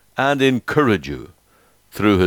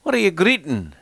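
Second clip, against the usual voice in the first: put-on high-pitched Scottish child's question; little boy's Scottish dialect; 7 semitones higher than usual. A narrator's reading. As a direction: ask it as a Scottish little boy. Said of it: "in his quaint Scottish dialect".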